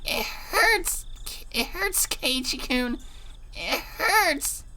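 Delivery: high pained whimper. Acted. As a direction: whimper through the pain. Rena in pain, fingers trapped.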